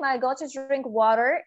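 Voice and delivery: slow American take, "water" as "waa-rer"; American tapped T and curled final R in "water"; American English. Shown as a demonstration. Teacher asks to drink water, American English demo. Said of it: "But in American".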